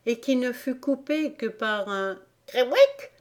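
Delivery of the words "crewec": cri du griffon. A narrator's reading. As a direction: squawk it out like a griffon. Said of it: "que poussa le griffon". (cri aigu de griffon)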